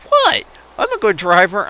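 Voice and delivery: shrill high indignant protest; sister's defensive reply. Retold or imitated. Sister insisting she drives well, high indignant protest. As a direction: play the offended sister. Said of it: "And I'm like".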